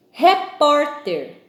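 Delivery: teacher's stress demo, Repórter with a long strong PÓR; stressed middle syllable. Shown as a demonstration. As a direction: stretch and punch PÓR in Repórter. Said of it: "ouvir qual é a sílaba forte".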